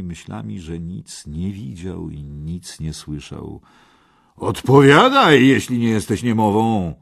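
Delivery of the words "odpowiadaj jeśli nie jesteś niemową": very loud, high command; impatient shout. A narrator's reading. Kej demands a reply from the silent knight, impatient shout. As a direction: shout for an answer, losing patience. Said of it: "krzyknął Kej".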